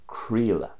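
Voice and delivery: "creala" as KREE-la; Connacht pronunciation. Shown as a demonstration. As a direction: say "creala" with an E sound in the middle. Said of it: "a bit of an E sound".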